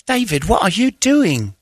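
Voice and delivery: put-on high, slow, scandalised girl voice; imitating her shocked protest; mock British girl. To David, a high mock-shocked girl's voice.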